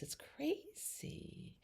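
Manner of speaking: breathy whispered aside; whispering. Real, not acted. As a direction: drop to a whisper.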